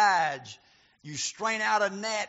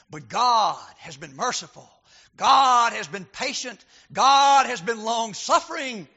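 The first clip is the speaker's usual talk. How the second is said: louder, ringing stress on God; preaching emphasis; 2 semitones higher, 8 dB louder than usual. Acted. Preacher stressing God's patience, rising full-force preaching. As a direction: press each line harder.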